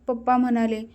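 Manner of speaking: ordinary delivery, nothing marked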